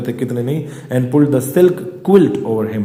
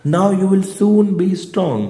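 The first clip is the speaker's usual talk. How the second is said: comforting nurse; 6 semitones higher, much slower than usual. Acted. Nurse comforts wounded prisoner.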